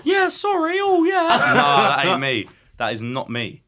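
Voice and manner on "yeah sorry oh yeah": high, loud, laughing mock of a meek yes-man; mocking meek pushover. Mocking the idea he'd meekly back down to Jax. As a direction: squeak out a spineless apology. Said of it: "I'm not some bitch that's just gonna go".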